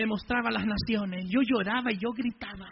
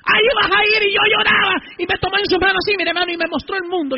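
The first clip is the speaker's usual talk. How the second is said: high, loud, sustained proclamation; full-force preaching; 9 semitones higher, 10 dB louder than usual. Real, not acted. God sending him out to preach, retold in full-force preaching. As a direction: shout the calling out to the crowd.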